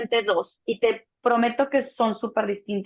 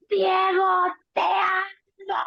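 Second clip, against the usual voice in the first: higher, slow cry of love in labor; mock labor cry; 8 semitones higher, much slower than usual. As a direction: call out to Diego mid-contraction, drawn out. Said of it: "Le gritaba". (gritándole a Diego en el parto, más agudo y lento)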